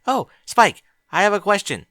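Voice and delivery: high, loud sing-song call through the door; Twilight calling. Acted. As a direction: call out brightly to Spike.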